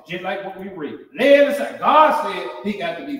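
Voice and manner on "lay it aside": preaching emphasis. Real, not acted. Preacher repeating the refrain to the congregation, high and loud. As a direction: punch "Lay it aside" high and loud. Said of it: "preacher repeats the refrain".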